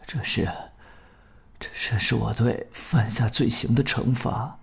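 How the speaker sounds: halting, very soft, dying man; faltering weak voice. Acted. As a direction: push out each word weakly. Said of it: "断断续续地说". (断断续续、虚弱地说)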